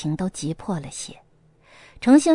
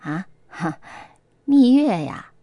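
feigned relief; 2 semitones lower, slower than usual. A narrator's reading. Mother hearing of her ex, faking relief. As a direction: pretend to be unbothered. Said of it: "又假装释然道".

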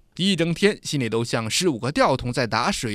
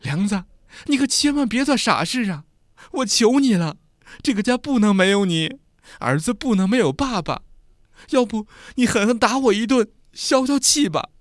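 much higher, desperate pleading; wife kneeling, begging her husband; frightened begging; 8 semitones higher, 3 dB louder than usual. A narrator's reading. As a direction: beg on her knees. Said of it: "春秀吓得扑通一声跪倒".

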